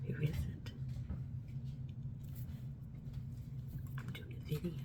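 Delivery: hushed whisper to someone who walked in; quick whispered aside. Real, not acted. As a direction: whisper off-camera so the video isn't disturbed. Somebody came in mid-recording.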